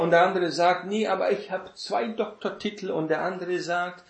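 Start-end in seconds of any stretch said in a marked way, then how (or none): 0.9-2.9 higher, smug mock outdoing; lightly voiced smug rival; mock one-upping. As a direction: top the other guy's boast. Imagined quarrel, second boaster.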